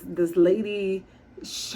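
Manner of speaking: usual speaking voice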